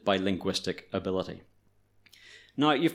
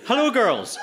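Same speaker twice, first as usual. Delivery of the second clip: showy greeting; 11 semitones higher, 4 dB louder than usual. Retold or imitated. Translating his hammed-up greeting to friends.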